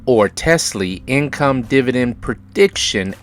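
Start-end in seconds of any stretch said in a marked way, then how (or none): none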